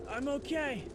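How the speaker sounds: shouted from far off, softened by distance; reassuring shout from beyond the fire; muffled shout. Acted. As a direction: call back through the flames. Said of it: "he shouted".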